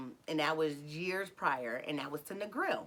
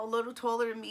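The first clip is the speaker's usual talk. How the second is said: normal talking voice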